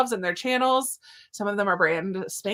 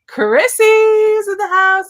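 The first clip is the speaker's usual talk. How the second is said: higher, louder and slow, like an MC; hype-man call; hype announcement; 11 semitones higher, 11 dB louder, much slower than usual. Real, not acted. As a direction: announce her arrival with big excitement. Hyping a viewer's arrival.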